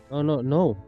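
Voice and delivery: ordinary talk, nothing marked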